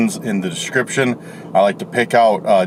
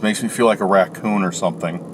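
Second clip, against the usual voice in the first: usual speaking voice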